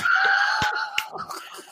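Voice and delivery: very high delighted shriek of laughter. Real, not acted. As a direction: burst out in a squealing laugh. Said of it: "Oh my gosh".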